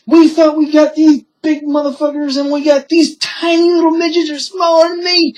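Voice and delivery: very high, loud imitation of ranting mother; mock indignant rant. Retelling mother berating the small cops, mock indignant. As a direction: wail it in a high pitch, mimicking her.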